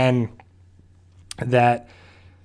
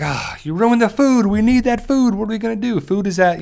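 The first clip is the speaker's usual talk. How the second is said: imitating Carol scolding; 9 semitones higher than usual. Carol scolding the dog for ruining the food, higher mock scolding. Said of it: "and Carol's like".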